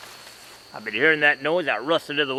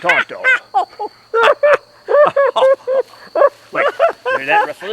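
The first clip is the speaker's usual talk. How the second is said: very high mock-startled squeak; hammed-up frightened falsetto; squeaky alarm; 6 dB louder than usual. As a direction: yelp it in a high panic.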